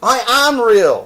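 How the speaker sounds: high, loud plea; near-tearful protest. Acted. Toy rabbit insisting he is real, high and loud. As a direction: plead your reality. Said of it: "said the little rabbit".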